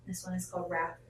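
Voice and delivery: ordinary talk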